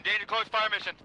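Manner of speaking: urgent shouted radio call; radio fire call. Acted. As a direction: bark it over the radio.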